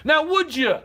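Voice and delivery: loud, high demand; shouted challenge. Real, not acted. Vlogger shouts the challenge at a critic. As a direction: bark the question at them.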